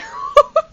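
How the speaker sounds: high squealing burst of laughter; loud high-pitched laugh. Real, not acted. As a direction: crack up in shrieks. Laughs at own discovery.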